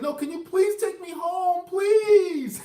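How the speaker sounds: high, loud mock desperate plea; imitating a crying plea. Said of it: "she started to cry".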